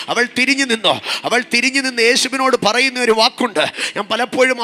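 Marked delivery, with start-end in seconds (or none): none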